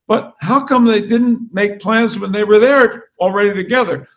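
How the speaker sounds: animated book reading in a dog's voice; story narration. A narrator's reading. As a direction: read it like a comic novel to a crowd. Reads the dog narrator's book aloud.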